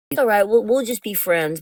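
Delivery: imitating his supposed okay-with-it reply; playing the friend card. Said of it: "he was playing the friend card".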